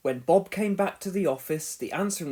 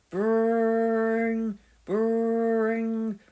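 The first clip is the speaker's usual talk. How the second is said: phone ring; 6 semitones higher than usual. A narrator's reading. The phone rings while Pilchard sleeps, an imitated ring. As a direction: ring it out like an old telephone.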